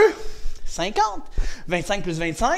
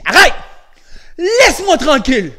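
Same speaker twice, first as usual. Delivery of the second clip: firm demo shout; 5 semitones higher, 9 dB louder than usual. Shown as a demonstration. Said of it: "tu lui dis".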